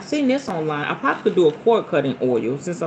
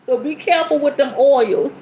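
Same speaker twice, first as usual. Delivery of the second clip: laughing warning; 7 semitones higher, 3 dB louder than usual. Real, not acted. Laughing after her sex story.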